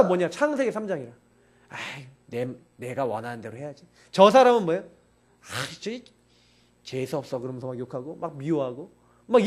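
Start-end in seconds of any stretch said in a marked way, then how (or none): 1.7-3.8 selfish grumble. Retold or imitated. Self-willed sinner voiced in sermon. 4.8-7.4 whispered sneer, much softer and lower; scornful whisper. Retold or imitated. The preacher voicing a believer who sneers at a neighbour, whispering.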